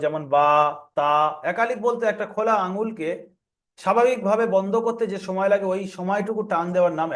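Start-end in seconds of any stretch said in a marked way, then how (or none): 0.3-1.1 বা, তা, each slightly lengthened, as in a reading lesson; one-beat stretch. Shown as a demonstration. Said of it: "একালিপ পরিমাণ টান দিয়ে পড়তে হয়".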